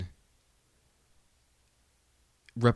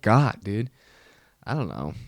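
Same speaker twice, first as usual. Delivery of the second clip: ordinary talk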